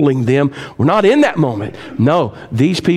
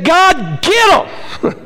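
mock vengeful plea; much slower than usual. Mocking vengeful prayer.

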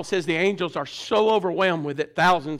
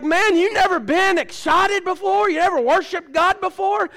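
retold incredulous challenge; 9 semitones higher than usual. Challenging a stiff preacher in retold quote.

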